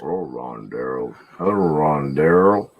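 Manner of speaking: low, slow drawn-out greeting; mock deep voice hello.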